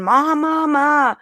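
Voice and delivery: high, yelping dog cries of mama; dog calling mama in a high wail; dog screaming mama. Retold or imitated. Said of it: "just screaming".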